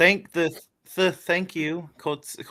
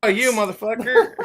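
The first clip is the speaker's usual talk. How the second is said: laughing while cursing; laughing curse; 9 semitones higher, 6 dB louder than usual. Real, not acted. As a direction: blurt it out mid-laugh. Laughing at a friend's jab.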